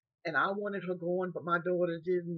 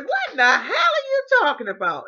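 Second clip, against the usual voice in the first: higher and louder, outraged; baffled shout; 11 semitones higher, 13 dB louder than usual. Retold or imitated. Old woman shouting back at the caregiver, outraged.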